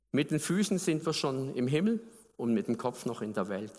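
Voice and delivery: normal talking voice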